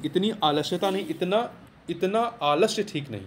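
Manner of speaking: ordinary talk, nothing marked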